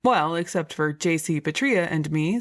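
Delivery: ordinary delivery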